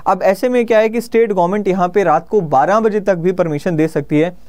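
ordinary delivery

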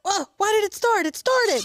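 high, loud surprised cry; surprised squeal. Real, not acted. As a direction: yelp as it suddenly starts. Machine suddenly starts.

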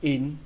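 "In" dropping in tone; falling tone. Shown as a demonstration. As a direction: let "In" fall like a huyền tone. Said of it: "thêm dấu huyền cho nó để cho nó xuống giọng".

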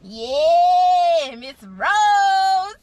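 loud, long-held holler; whooping call to a neighbor. Real, not acted. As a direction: holler it out, stretching the words. Calling out to someone.

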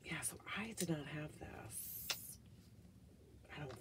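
soft unvoiced whisper aside. Real, not acted. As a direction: confide it under your breath. Aside while smelling candle.

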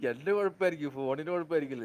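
speaking up. Real, not acted. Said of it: "അത് ഞാൻ ഈ പതുക്കെ പറഞ്ഞോണ്ട് കേൾക്കാത്തെ".

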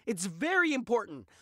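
normal talking voice

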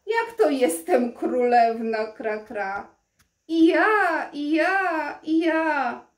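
loud jeering crow chorus; mocking crows. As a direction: jeer at her like a flock. Mocking crows laugh at her.

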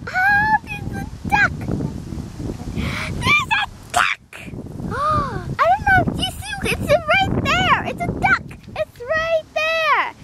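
high, squealing doll voice; thrilled, breaking the big news; high-pitched pretend excitement; excited doll. Doll spots a duck.

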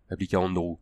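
ordinary delivery

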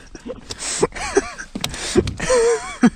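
long high cackling laugh; big laugh. Real, not acted. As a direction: bursts out laughing, high and loud. Buddy cracks up at fish.

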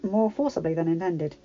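plain reading voice

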